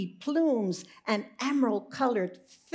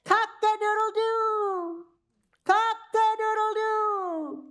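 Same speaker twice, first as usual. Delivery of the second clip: high, loud crowing call; rooster crow; 12 semitones higher, 5 dB louder than usual. Acted. Rooster waking the barnyard, loud high crow. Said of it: "Marvin crowed".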